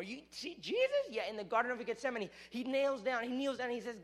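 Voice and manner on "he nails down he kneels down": usual speaking voice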